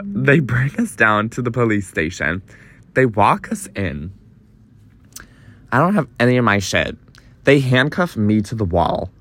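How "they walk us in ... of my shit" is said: ordinary talk, nothing marked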